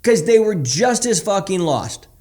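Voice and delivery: impassioned. Real, not acted. Emotional about lost friends.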